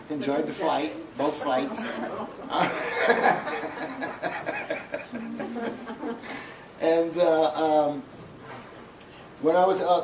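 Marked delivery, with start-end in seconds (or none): 1.9-6.7 long, real, high-pitched laughter; hearty laugh. Real, not acted. The poet jokes about enjoying both flights, long hearty laugh. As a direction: crack up at your own joke.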